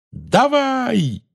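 high, drawn-out yell, held back in volume; urgent high-pitched cry; yelled command. A narrator's reading. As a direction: call it out long and high. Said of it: "завопил Гарри".

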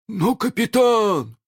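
высоко, протяжно протестуя; возрази капитану, не веря ушам; изумлённое возражение. A narrator's reading. Пират возражает приказу. (растерянный протест)

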